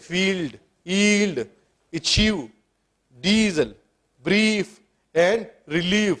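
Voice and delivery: "فیلڈ", "ڈیزل", "ریلیف" with a long, drawn-out ee; word by word, stretching the ee in "اچیو" and "بریف"; long double-E sound. Shown as a demonstration. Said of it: "double ای کا sound دے رہا ہے". ("فیلڈ"، "ڈیزل" میں ای کو لمبا کھینچ کر)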